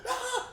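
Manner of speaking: squealed high yelp; shrill squeal; high mock scream. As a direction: let out a high startled cry. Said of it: "she's like".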